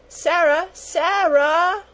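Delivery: put-on high, drawn-out child's cry for help; wailed call. A narrator's reading. Hurt little girl calling for Sarah, wailing. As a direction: wail out for your protector, high-pitched. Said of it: "wailed Lottie".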